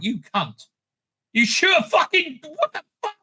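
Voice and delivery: rising outraged yell; outraged at dog shooting. Retold or imitated. Reacting to soldiers shooting a dog, outraged yell. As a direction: swear at them in disbelief. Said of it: "I'm like".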